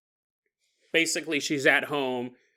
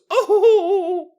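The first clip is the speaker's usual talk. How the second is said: high, loud "oh" of fright; hammed-up gasp of alarm, held out; mock startled wail; 10 dB louder than usual. Mom startled by what she sees.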